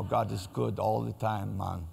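a hint of Jamaican lilt on the phrase; Jamaican accent. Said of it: "it sounds really good with the Jamaican accent".

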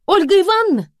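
high, loud call of a name; exasperated shout. A narrator's reading. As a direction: shout the name through a door. Mother demands recognition through door.

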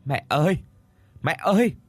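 high, plaintive call for mother; anguished calling. A narrator's reading. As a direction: call out for a lost mother in distress. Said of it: "cất lên những tiếng thảm não".

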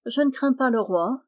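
scornful defiance with lifted pitch; disdainful. A narrator's reading. Proud lady scorns the princes' threat, disdainful. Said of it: "Azénore sourit dédaigneusement".

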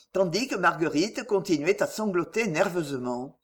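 usual speaking voice

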